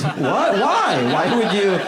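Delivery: high, loud "Why? Why would you?"; shocked boyfriend. Pretend. Comic boyfriend hearing the AI cheated, high and loud. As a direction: cry out in betrayed disbelief.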